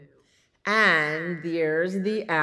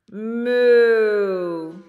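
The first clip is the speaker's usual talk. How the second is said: long, loud moo, pitched up; cow moo; 6 semitones higher, 12 dB louder than usual. Pretend. Storyteller springing the cow on children, booming moo. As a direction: imitate a cow's drawn-out moo. Animal noise in picture book.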